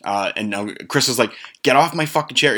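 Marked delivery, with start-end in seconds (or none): 1.6-2.6 higher mock yell; imitated angry order. Chris ordering Steve off his chair, mock angry. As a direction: act out an exasperated order.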